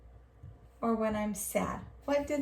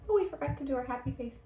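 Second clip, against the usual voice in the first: usual speaking voice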